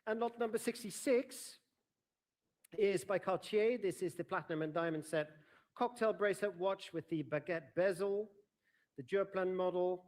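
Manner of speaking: bright formal lot announcement; auctioneer lot announcement. Real, not acted.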